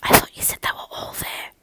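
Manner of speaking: breathy English whisper, no voice at all. A narrator's reading. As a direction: whisper it so the house can't hear. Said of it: "she heard whispering among her knights".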